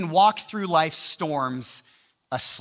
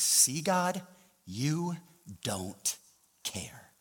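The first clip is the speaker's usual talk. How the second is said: resigned accusation; 7 dB quieter, much slower than usual. Retold or imitated. Pastor tells God his reaction to the storm, resigned. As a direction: let it drop away in quiet reproach.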